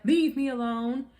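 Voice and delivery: mock angry. Angry man to the woman following him, mock irritation. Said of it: "if he's angry".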